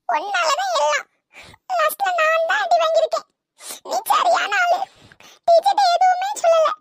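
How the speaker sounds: high, whimpering reproach; tearful. Acted. Punished pupil blaming her friend, tearful. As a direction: blame a friend through tears. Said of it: "அழாத sweetie".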